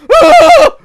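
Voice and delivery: very high, loud shriek; hammed-up screech voiced for the game; mock game scream. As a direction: let out a cartoon squeal of alarm. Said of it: "The game's like".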